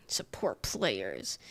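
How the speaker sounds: low, drawn-out breathy grumble; mock disapproval. Player imitating a signer shaking his head at supports, disapproving low grumble. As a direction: mutter it very slowly, deep and under the breath.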